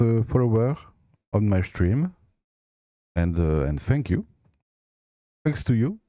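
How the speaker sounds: English spoken with a strong French accent; French speaker talking English. Real, not acted. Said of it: "j'ai un accent anglais très, très pourri".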